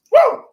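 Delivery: loud, high-pitched "woo"; excited whoop. Real, not acted. Host reacting to his crunch, loud whoop. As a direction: let out a sudden high whoop.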